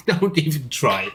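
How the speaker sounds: lifted, laughing brush-off; laughing repeat. Retold or imitated. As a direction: say it on the tail of a laugh. Repeats the line after laughing.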